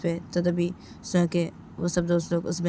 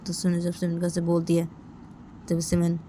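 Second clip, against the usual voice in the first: ordinary delivery, nothing marked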